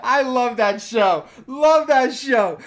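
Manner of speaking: loud, slow, gushing praise; gushing fan. Real, not acted. Streamer gushing to chat about a show. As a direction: drag out how much you love it.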